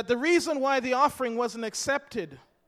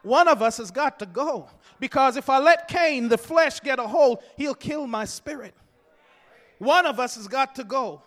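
rising preacher's cadence, loud; fiery sermon refrain; preaching; 5 dB louder than usual. Acted. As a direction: preach it with building force. Preacher's refrain at full force.

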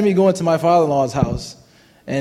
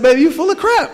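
raised high and louder, a retold snap at his wife; exasperated outburst; 11 semitones higher, 5 dB louder than usual. Husband in the car telling his wife she is full of crap, high and loud. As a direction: blurt it out at her, fed up. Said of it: "I said".

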